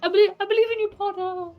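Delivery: encouraging. Acted. Cheering on a scared friend.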